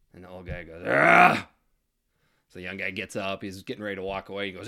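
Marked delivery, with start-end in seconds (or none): 0.9-1.5 grumpy grunt. Acted. Old guy grunts back.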